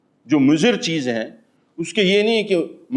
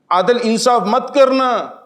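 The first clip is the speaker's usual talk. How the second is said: emphatic warning; 8 semitones higher, 4 dB louder, slower than usual. Acted. Preacher stresses a prohibition.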